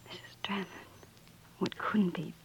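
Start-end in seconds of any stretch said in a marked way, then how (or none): 0.1-0.7 breathy awed whisper of the name. Acted. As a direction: whisper it to yourself, stunned. Shop girl reads name in awe.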